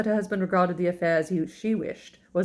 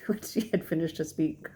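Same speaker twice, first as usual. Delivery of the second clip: plain reading voice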